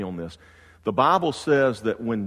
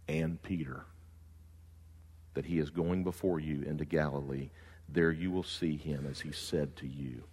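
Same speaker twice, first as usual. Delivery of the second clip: soft, low reading of the verses; hushed Bible reading; quiet scripture reading; 6 semitones lower, 9 dB quieter than usual. Acted. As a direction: read it hushed and reverent.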